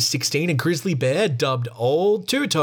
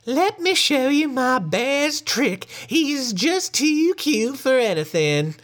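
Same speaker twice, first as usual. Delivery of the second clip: very high, slow falsetto lady; silly lady; 11 semitones higher, slower than usual. Acted. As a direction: coo it like a naive, doting lady. Lady calls to the people.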